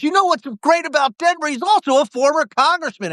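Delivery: high-pitched imitation of a bragging friend; excited mock boasting. Retelling Dean outing him to stranger.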